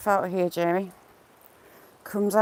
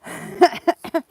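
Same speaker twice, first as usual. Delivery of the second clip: loud burst of giggles; laughing at a silly joke; real laughter; 9 semitones higher, 7 dB louder than usual. Real, not acted. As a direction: break into high, loud laughter. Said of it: "I'm laughing at Jamie's sticky balls".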